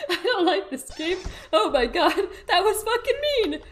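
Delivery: high laughing relief; nervous laughing. Real, not acted. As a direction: laugh off the fright. After the scare, laughing.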